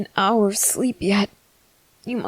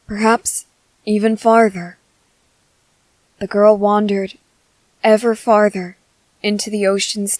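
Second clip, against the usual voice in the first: steady storytelling voice, a touch louder; bedtime story narration; 5 dB louder than usual. Acted. Father telling his baby a made-up bedtime story, steady. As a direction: tell the tale to lull the baby.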